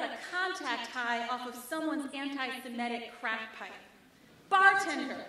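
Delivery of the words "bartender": loud call across the bar. Acted. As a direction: shout for the bartender over the noise. Calling out in a bar.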